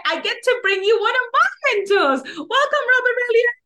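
bright, high podcast host intro, pitched well up; super excited. Real, not acted. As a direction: welcome the guest with big energy, voice lifted high. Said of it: "super excited".

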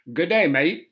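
stock Australian greeting accent. Shown as a demonstration. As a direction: put on a rough, amateur Australian accent. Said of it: "like, Australian accent, everybody can go".

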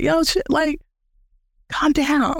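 exasperated big brother. Retold or imitated. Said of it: "it feels like a little brother where it's just like".

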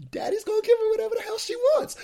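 very high, fast comic voice; mock giving in. Dad admitting he's wrapped round her finger, squeakily. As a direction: race through it in a squeaky mock voice. Mocking his own giving in.

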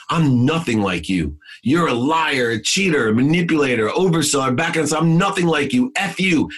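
furious outburst. Retold or imitated. Son hanging up on father, building to a furious shout. Angry phone fight with father.

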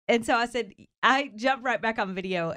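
ordinary delivery

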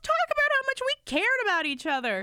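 very high, upset protest; retold tearful outburst. Woman retelling her crying reply to him, upset protest. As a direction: replay an indignant, tearful comeback in a high voice. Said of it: "I was crying".

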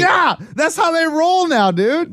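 high, loud excited shout. Real, not acted. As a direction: shout it out laughing. Laughing loud reply.

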